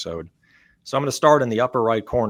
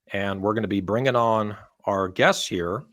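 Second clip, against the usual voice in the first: ordinary talk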